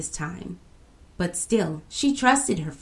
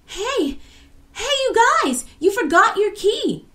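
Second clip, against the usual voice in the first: very high, loud holler; calling shout; 6 dB louder than usual. A narrator's reading. Girl running towards the friends, high calling shout. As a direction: call to friends far away. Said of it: "shouted the girl".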